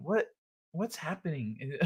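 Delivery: softly imitated bewilderment; confused host. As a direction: play a host quietly losing the thread. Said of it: "They were confused".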